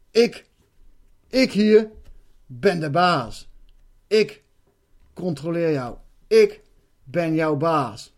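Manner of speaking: loud, slow, domineering monster voice; the evil octopus entity claims control, slow and loud; menacing entity. Retold or imitated. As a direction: lay down who is master, word by word. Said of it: "Waarop dat ding begon van".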